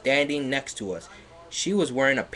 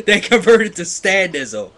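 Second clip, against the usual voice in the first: laughing loud; 8 semitones higher, 11 dB louder than usual. Real, not acted.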